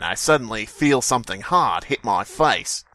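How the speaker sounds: ordinary talk, nothing marked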